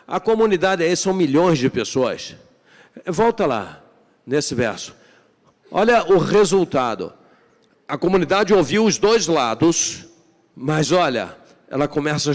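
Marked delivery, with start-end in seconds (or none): none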